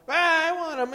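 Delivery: high, loud mock whine; fussing little girl. Little girl demanding cookies in the store, fussing. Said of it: "little girl started making a big fuss".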